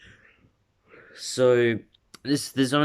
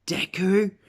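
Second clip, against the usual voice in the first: high questioning call, rising; startled recognition; 10 semitones higher than usual. Acted. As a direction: say it like a surprised double take. Character spots someone he recognises.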